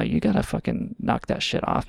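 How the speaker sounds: ordinary talk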